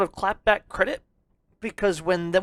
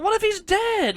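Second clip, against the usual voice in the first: mock panic; 11 semitones higher, 4 dB louder than usual. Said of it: "I just picture R two freaking out".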